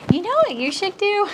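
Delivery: high-pitched mock busybody suggestion; conversation opener. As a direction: put on a high, pushy suggestion voice. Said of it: "start every conversation with".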